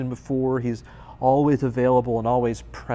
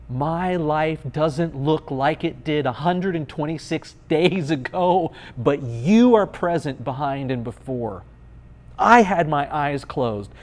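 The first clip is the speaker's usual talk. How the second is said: loud, raised-pitch sermon climax; impassioned preaching; 4 semitones higher, 6 dB louder than usual. Real, not acted. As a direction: build with force, stretching key words.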